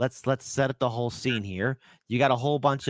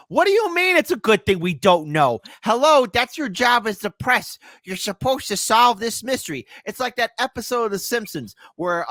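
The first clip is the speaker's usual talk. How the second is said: loud and higher, indignant outburst at the press; exasperated rant; 9 semitones higher, 5 dB louder than usual. Real, not acted. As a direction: hammer the press for not doing its job. Host rants at the press.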